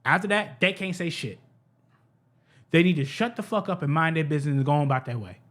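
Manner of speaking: ordinary talk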